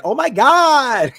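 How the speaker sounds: high, loud, drawn-out mock cry; imitated yell. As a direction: stretch it out in a mocking high yell. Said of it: "he's yelling out".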